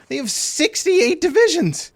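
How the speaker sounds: high, incredulous outburst; shocked disbelief. Real, not acted. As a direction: voice leaps up in astonishment. Shocked at enemy unit count.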